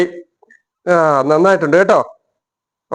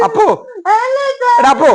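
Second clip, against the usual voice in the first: sharp call. Real, not acted. Father calls son sharply.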